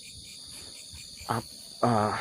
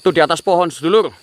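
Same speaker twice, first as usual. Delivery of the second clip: loud, higher urgent shout; excited alarm; 7 semitones higher, 12 dB louder than usual. Real, not acted. Host spots something in the tree, urgent loud shout. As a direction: point it out before it vanishes.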